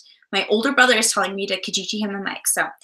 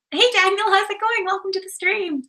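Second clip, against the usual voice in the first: bright welcome; 9 semitones higher than usual. Real, not acted. Greeting a viewer joining the stream.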